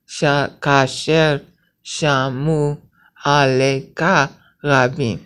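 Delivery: "kasher shamu aleka rabim" drawn out, every syllable set apart; deliberate syllable-by-syllable reading; very slow syllable count. Shown as a demonstration. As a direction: spell out the nine beats slowly. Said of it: "see how it's nine syllables".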